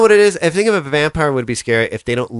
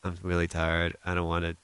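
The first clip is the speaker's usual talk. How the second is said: tired brush-off; 6 semitones lower, 8 dB quieter than usual. Retold or imitated. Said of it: "did you say".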